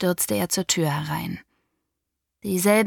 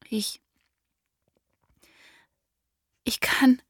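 faltering, first word barely audible, then a higher break; voice failing; 6 semitones higher than usual. Acted. Daughter frightened before her father, voice breaking. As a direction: stammer as the voice gives out. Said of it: "meine Stimme versagte".

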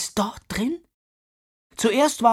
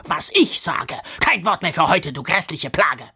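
loud, spitting anger; furious scolding; 6 dB louder than usual. Acted. Misses Crabone furiously scolding Katrina. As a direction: tear into her with hate. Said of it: "vor Wut und vor Hass".

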